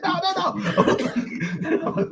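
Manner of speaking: very high mock-female protest; squeaky put-on refusal through laughter; imitated female cop. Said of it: "She's like".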